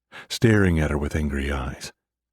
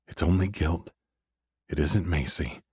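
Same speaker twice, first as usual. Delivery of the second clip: ordinary delivery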